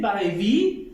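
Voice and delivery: ordinary talk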